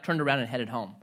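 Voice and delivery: normal talking voice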